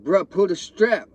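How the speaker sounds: high, loud, slow urgent plea. Retold or imitated. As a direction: beg him to pull the gun, loud and slow. Begging friend to draw gun.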